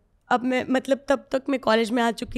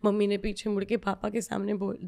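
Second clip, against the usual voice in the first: ordinary delivery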